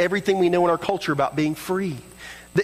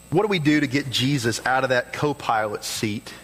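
ordinary delivery

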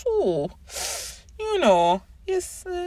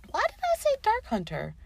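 ordinary talk, nothing marked

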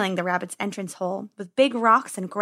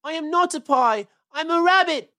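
wailing. Acted. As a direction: wail it like a scared kid. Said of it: "wailed Peter suddenly".

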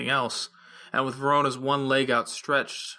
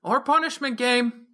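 a high raised shout from the crowd; calling out; 11 semitones higher, 3 dB louder than usual. A narrator's reading. A partygoer calls out a high raised alternative. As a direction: call it from across the room. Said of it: "someone called out".

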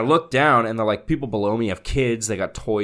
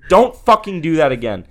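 raised, stern warning; retold yelling; 5 semitones higher, 6 dB louder than usual. Podcaster replaying his final warning to the neighbor, yelling. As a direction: lay down the law, louder and higher. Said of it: "I yelled at him".

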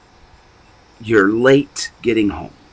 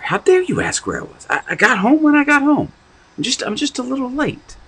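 angry retort; faster than usual. Acted. Preacher acting an angry partner snapping back at a worried spouse. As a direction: fire back at your spouse in outrage. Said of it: "your response is out, out of anger".

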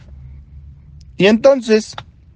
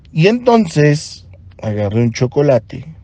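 ordinary talk, nothing marked

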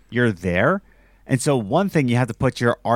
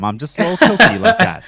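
high, fast and giggly; laughing; 8 semitones higher, 7 dB louder, much faster than usual. Pretend. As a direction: giggle through it playfully. Jokes about own quirky self.

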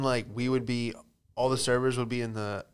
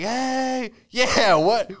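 mock customer greeting; 10 semitones higher, 6 dB louder, much slower than usual. Customer walking into kitchen.

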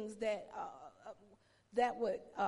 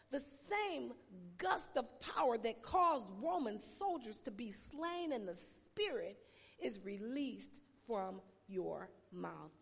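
usual speaking voice

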